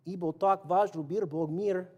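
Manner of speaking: Russian with a playful Mexican accent, laughing. Shown as a demonstration. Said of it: "speak Russian with a Mexican accent".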